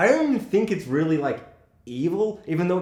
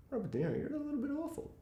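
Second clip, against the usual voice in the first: very soft, high, quick aside; timid inner reaction; 11 semitones higher, 13 dB quieter, faster than usual. Retold or imitated. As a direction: say it small and quick, under your breath. Voicing own reaction to film.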